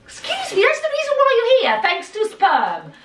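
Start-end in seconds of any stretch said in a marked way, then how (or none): 0.0-1.6 high, fast mock scolding; indignant parental telling-off. Real, not acted.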